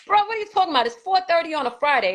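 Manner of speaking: high, exasperated bro; exasperated disbelief. Real, not acted. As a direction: pitch jumps up in disbelief. Officer says file a report.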